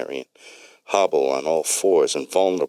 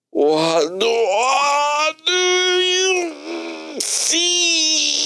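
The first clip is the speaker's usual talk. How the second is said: very high, drawn-out, crying wail; distressed wail; much slower than usual. Acted. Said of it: "while in great distress".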